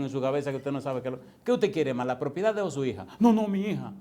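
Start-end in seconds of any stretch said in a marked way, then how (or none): 3.2-3.9 loud, high, firm no, no; emphatic choice. Retold or imitated. Mother choosing her daughter over property, loud and firm. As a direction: insist on the daughter firmly.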